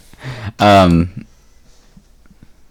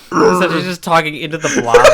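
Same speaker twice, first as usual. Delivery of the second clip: laughing; 6 semitones higher, 10 dB louder than usual. Real, not acted. Laughing at joke.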